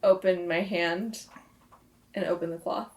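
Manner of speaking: normal talking voice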